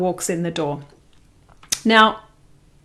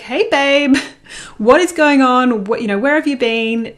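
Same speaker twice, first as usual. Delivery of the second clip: raised, louder voice, wanting an answer; puzzled calling out; 4 semitones higher, 4 dB louder than usual. Retold or imitated. Partner arriving late, she greets him with a puzzled call. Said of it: "she'll be like".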